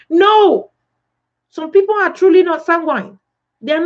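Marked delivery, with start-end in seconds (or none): 0.1-0.7 loud, high, emphatic no; emphatic rebuttal. Real, not acted. Preacher rejecting an imagined excuse, emphatic and loud.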